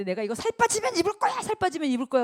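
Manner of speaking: high, loud mock protest; 집착하는 흉내. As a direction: refuse like a clinging hoarder. 옷을 못 버리는 사람 흉내. (옷을 버리지 못하는 사람의 높은 항의 흉내)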